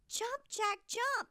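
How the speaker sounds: high, loud urgent shout; hollering. Acted. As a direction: holler up at her son to hurry. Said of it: "she hollered".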